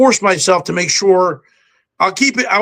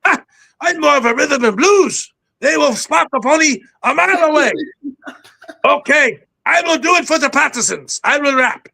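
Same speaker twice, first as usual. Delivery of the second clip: higher pitched cartoon roach general with a hint of a Cuban accent; 5 semitones higher than usual. Auditioning General Kakamoto for the movie director, high cartoon voice with a light Cuban accent. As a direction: play a proud, desperate roach commander rallying his troops. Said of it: "I wanted to make him a little bit like a Cuban".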